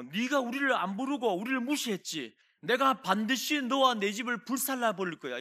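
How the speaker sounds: loud, high, menacing threat; furious threat of burning the house; threatening shout. Acted. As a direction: yell it like you mean to burn the house down. Ephraimites threaten Jephthah.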